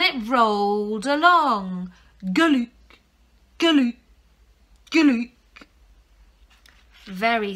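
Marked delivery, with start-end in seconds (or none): 2.2-5.3 rolling sound effect. A narrator's reading.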